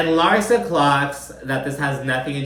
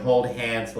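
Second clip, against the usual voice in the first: ordinary delivery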